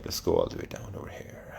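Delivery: breathy whispered aside while scrolling. Real, not acted. Streamer whispers to himself while scrolling down the page. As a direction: drop to a whisper, just for himself.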